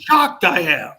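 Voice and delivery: hammed-up shocked exclamation, loud and high; mock shock. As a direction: play it as fake astonishment.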